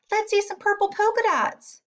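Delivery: a classmate calling out in the classroom; yelled out. A narrator's reading. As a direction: call it out like a teasing kid. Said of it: "One yelled out".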